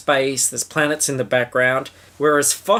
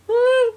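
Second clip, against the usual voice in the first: tiny falsetto eh; high squeak; 4 dB louder than usual. Pretend. Squeak and grunt routine.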